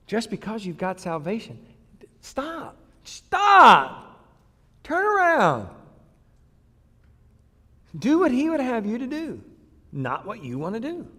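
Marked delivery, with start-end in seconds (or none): 2.2-9.4 high, rising call to stop and turn; urgent command. Acted. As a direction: plead with them to turn around. Pleading command to congregation.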